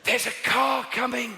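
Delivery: high, urgent shouted warning; shouting. Acted. Parent warns child of a car, urgent high shout. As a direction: yell it at a child in danger. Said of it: "not supposed to shout at children".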